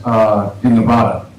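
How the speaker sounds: ordinary talk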